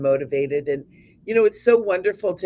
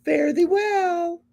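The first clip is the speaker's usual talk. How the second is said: theatrical farewell; 13 semitones higher, much slower than usual. Pretend. Playful archaic farewell.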